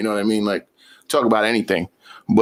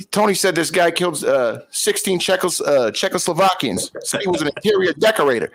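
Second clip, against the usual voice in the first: higher, bemused wiseguy voice; mobster voice; 6 semitones higher than usual. Retold or imitated. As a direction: tell it like a Jersey wiseguy. Quoting mobster character.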